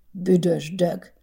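slow muttered curse, held back; fuming. A narrator's reading. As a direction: seethe it under the breath. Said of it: "fortyogott Boldizsár".

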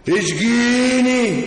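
slow, stretched mock plea; drawn-out teasing plea. Repeated, drawn out and louder.

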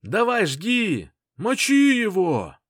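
slow, high, loud fan shout; yelling from the sidelines. Acted. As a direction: roar it from the bleachers. Crowd cheering a fighter.

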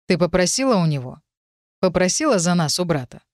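plain reading voice

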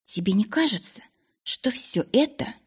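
falter, unsure of herself; hesitant young woman. A narrator's reading. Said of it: "Она замялась". (робкий девичий голос)

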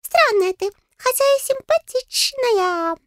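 high, squeaky little voice; tiny fairy, slowing as it appraises; frank fairy. A narrator's reading. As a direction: declare it in a piping voice. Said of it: "заявил Густав".

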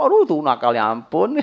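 loud mock exasperation; exasperated grandparent. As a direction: sigh out a fed-up mock complaint. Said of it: "Jangan ngomong nakal".

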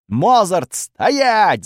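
high, loud yelled command; full-throated yell. A narrator's reading. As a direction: yell at the top of your lungs. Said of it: "заорал я во всю глотку".

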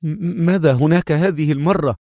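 high, agitated, stammered question. A narrator's reading. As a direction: ask anxiously, tripping on the first word. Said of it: "فهمس خالد في انفعال".

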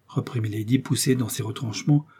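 usual speaking voice

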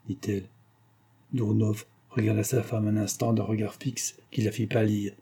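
ordinary delivery

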